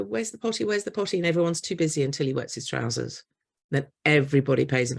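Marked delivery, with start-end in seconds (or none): 0.0-1.2 put-on small child asking. Toddler pleading for the potty.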